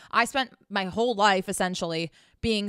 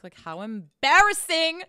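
mock embarrassment; 6 semitones higher, 5 dB louder, slower than usual. Real, not acted. Mock horror at own ebook.

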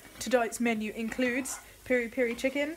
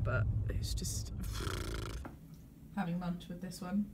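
1 trailing whisper; 3 semitones lower, 9 dB quieter than usual. Real, not acted.

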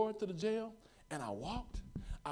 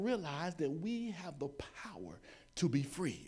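sudden whisper. Real, not acted. Preacher whispering about freedom to the congregation, hushed. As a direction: pull back and whisper it.

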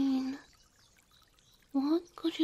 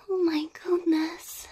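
amazed gasp; 4 semitones higher than usual. Acted. Blindfold off, sees garden.

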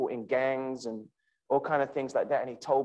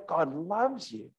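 soft tender assurance; 5 dB quieter, slower than usual. Real, not acted. Addressing Eliza personally.

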